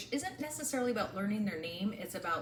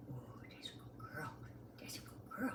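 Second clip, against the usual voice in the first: whispered praise; 5 semitones lower, 13 dB quieter than usual. Real, not acted. Praising puppy softly.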